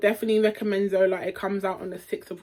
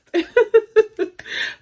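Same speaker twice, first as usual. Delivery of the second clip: very high, loud laughter; giddy laugh; 10 dB louder than usual. Real, not acted. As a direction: crack up at yourself. Giddy about being taken for press.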